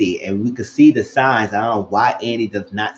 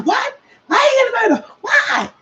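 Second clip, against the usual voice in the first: very high-pitched mock shriek; angry outburst. Woman reacting to a murder arrest, in a high angry shout. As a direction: imitate her shouting in outraged disbelief. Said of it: "why you so angry over that".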